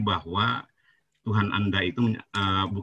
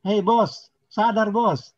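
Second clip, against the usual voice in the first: higher, pushing call to wake up; raised-pitch scolding of a livestream opponent; heated call-out; 6 semitones higher than usual. Real, not acted. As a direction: snap him out of it, voice up high. Heated debate, calling out opponent.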